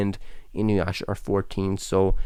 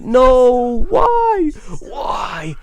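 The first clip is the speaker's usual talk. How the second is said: loud, high cry of frustration; frustrated cry; 10 dB louder, much slower than usual. Real, not acted. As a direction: wail why at the mistake. Accidentally rewound the video.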